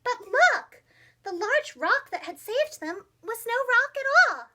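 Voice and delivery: high, loud surprised reveal. A narrator's reading. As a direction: gasp in wonder at it.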